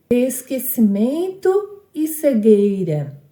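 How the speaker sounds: slow, drawn-out reading of "esquecimento e cegueira"; verse read syllabically. Shown as a demonstration. Teacher reading the third verse for the class, slow and measured. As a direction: stretch the verse out for counting syllables. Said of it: "O terceiro verso".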